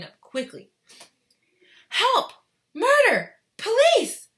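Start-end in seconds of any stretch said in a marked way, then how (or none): 1.9-4.3 very high, loud hysterical screams. Acted. Mother screaming for police. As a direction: shriek for help. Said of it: "screamed Missus Gloop".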